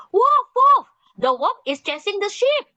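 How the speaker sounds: high, loud cry of Wolf. Acted. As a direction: shout it like a panicked boy. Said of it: "He cried out loudly".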